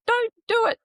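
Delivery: high-pitched yelled warning; mock yell. Body warning its owner off a job, high mock yell. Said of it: "your body actually yelling at you".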